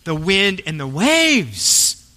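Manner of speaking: ordinary talk, nothing marked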